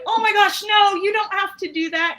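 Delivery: gush, overjoyed by the free offer; delighted surprise. Retold or imitated. Offered free pizza by the Costco manager, thrilled protest. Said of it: "And suddenly I'm like".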